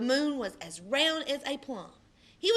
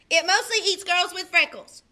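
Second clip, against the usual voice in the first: put-on teasing boy; 7 semitones higher, 9 dB louder than usual. Austin teasing Amy.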